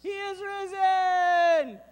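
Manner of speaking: joyful yell. Shown as a demonstration. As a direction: shout it over the whistle. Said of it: "And I'm gonna yell".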